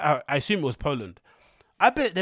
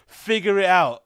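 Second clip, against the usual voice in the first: mock man lecturing; 3 semitones higher, 7 dB louder, slower than usual. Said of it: "Imagine if a man said that to her".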